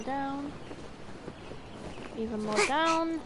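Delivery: ordinary delivery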